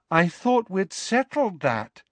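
maddeningly serene. A narrator's reading. Luna to Harry, maddeningly calm and slow. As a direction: float it out as if obvious. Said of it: "said Luna maddeningly".

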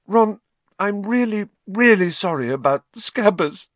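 put-on a mild sobbing girl apologising; sobbing apology. A narrator's reading. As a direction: plead through sobs. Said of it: "she sobbed".

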